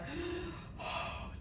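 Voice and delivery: long, exaggerated princess sigh; big sigh. A narrator's reading. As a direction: heave a great theatrical sigh. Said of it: "heaved a great big sigh".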